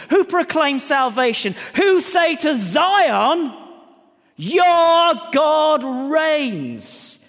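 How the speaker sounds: preacher reading Isaiah; scripture proclamation. Acted. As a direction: proclaim it, swelling to a triumphant close. Reads scripture with rising fervour.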